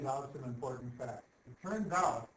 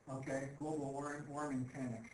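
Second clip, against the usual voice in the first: ordinary talk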